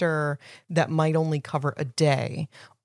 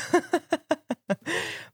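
high laugh; 12 semitones higher than usual. Real, not acted. Amused at attempt.

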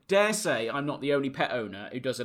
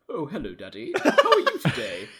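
put-on pet voice with a laugh in it; dog's imagined voice. Owner speaking as his dog Daisy, playful put-on voice. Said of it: "doing a voice for Daisy".

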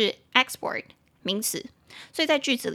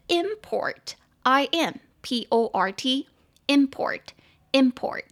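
"import" stressed on IM, slow and higher; stress on first syllable; 3 semitones higher, much slower than usual. Shown as a demonstration. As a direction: spell it out slowly, say "import" as IM-port. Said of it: "重音节在前面是名词".

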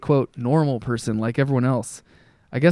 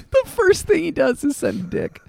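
laughing; 11 semitones higher than usual. Real, not acted. Laughing while talking.

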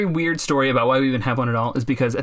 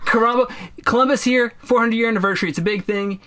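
mocking the United States; 8 semitones higher than usual. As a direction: ham up a proud official announcing Columbus. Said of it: "And so the United States is like".

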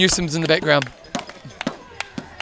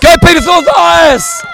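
cheering shout; 12 semitones higher, 11 dB louder, much slower than usual. Real, not acted. Cheering a passing rider.

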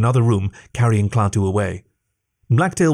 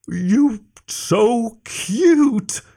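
put-on high, very slow baby-talk coo; cooing giant; 12 semitones higher, much slower than usual. A narrator's reading. Giant to a tiny captive, high slow cooing. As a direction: stretch out each word in a high giant coo.

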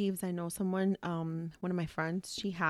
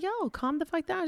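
tag-worthy protest; 9 semitones higher than usual. Retold or imitated. Retelling her reply to outburst.

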